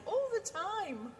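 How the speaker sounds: high, slow catchphrase; mimicking Randy. Shown as a demonstration. Host showing the catchphrase to listeners, high and drawn out. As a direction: draw it out high and teasing. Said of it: "what I mean when I say".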